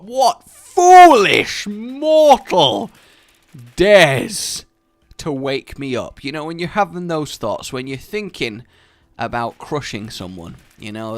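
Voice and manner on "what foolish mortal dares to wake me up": booming, very slow villain voice; evil overlord. Retold or imitated. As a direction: roar it like an awakened demon. Mock villain voice.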